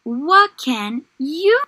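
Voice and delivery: gentle storybook read-aloud; reading to children. Acted. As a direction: read it like a picture book for little ones. Teacher reads story to class.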